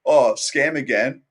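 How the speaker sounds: loud sneering mock of the critics. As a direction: jeer it like a scornful commenter. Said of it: "people are gonna be like".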